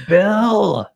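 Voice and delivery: high loud call. Real, not acted. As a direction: call him across the house. Calling out a name.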